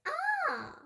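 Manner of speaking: very high short yelp; startled cry. Acted. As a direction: jump with fright. Child startled.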